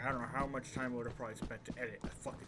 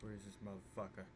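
hushed, slow search for the monster; whispering while hiding; 5 semitones lower, 13 dB quieter, slower than usual. Real, not acted. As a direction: keep it barely voiced, stay hidden.

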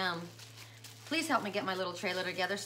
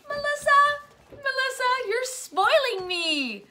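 high, loud delighted squeal of the name; excited squeal; 7 dB louder, slower than usual. Real, not acted. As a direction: gush over the surprise gift. Excited gift reaction, high squeal.

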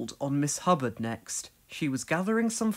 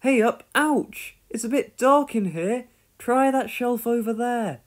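startled ouch; 8 semitones higher, 4 dB louder than usual. A narrator's reading. Pat bumping in the dark.

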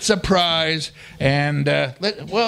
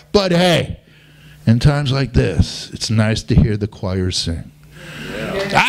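usual speaking voice

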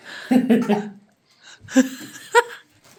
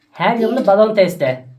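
giggly helium voice. Real, not acted. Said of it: "hemen sesim kendi haline dönüyor". (helyumlu ince ses)

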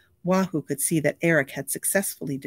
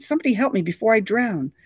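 higher, desperate cry; panicked plea; 7 semitones higher, 4 dB louder than usual. A narrator's reading. Drowning star begging somebody to help him. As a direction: beg for rescue.

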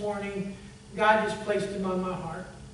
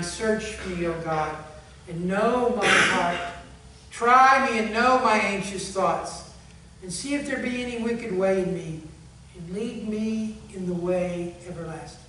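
scripture reading; 3 dB louder than usual. Acted. Reads Psalm 139 aloud.

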